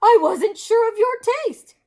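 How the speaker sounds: high, loud, gushing hostess; Missus Moose voice. Acted. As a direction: gush at him, high and bright.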